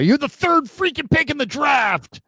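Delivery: high, loud outburst of disbelief; loud, high-pitched sports-fan rant; exasperated shout. Real, not acted. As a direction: yell it in sheer exasperation. Exasperated outburst at failed pick.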